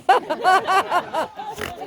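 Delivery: loud rising laugh, pitched high; real laughter. Real, not acted. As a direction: laugh at the failed plan. After the border rehearsal story.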